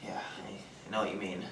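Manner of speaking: hushed, breathy whisper. Acted. Dean whispering to a coworker outside the party. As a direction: whisper it back, barely voiced.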